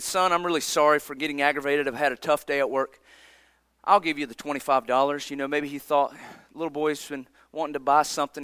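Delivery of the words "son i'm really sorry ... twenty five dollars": apologetic father. Retold or imitated. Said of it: "and he said".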